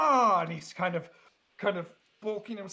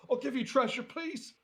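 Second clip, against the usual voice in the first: mock pleading crab; 8 semitones higher than usual. Crab begs offering treasure.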